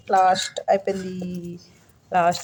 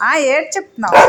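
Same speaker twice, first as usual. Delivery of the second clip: loud, higher scolding of the pet; 7 semitones higher, 11 dB louder than usual. Acted. Owner to the complaining pet, loud teasing scold. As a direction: scold it with full voice.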